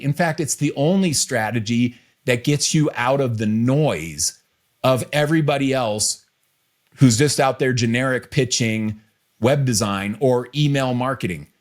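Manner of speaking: ordinary delivery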